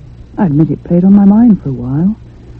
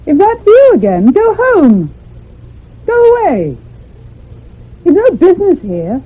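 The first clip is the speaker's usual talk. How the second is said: shouting away; 11 semitones higher than usual. Acted. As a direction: drive the unseen child off with a shout.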